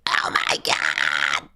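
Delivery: very low, slow growled exclamation; put-on monster voice. Streamer hamming up the countdown, deep drawn-out voice. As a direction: drag it out deep and hammed-up.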